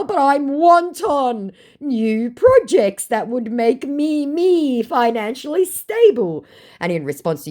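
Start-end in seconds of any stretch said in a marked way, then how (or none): 0.0-6.5 higher, slow, put-on voice; mocking scammer voice. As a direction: ham up the scammer's sob story slowly. Mimicking the scammer's excuse.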